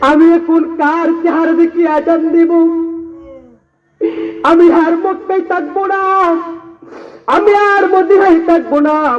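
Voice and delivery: high, impassioned sermon voice; fervent waz preacher pleading; preaching at full force. Acted. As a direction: plead as Abu Bakr from the pulpit. Bilal's lament, preached.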